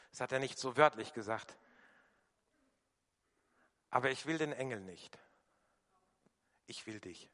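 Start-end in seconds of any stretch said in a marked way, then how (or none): 3.9-7.3 quiet plea. Acted. Moses pleads with God.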